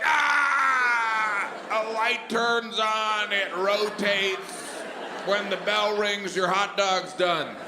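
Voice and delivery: high, slow panicked explanation; panicked babbling. Pretend. Under threat, explaining the microwave in a high, slow panic. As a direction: blurt it out like a gun is at your head.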